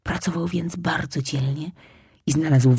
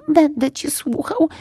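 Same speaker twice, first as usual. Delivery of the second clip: obedient; 11 semitones higher than usual. Acted. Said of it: "posłusznie odparł Lisek".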